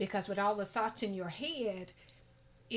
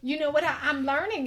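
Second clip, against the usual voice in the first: ordinary delivery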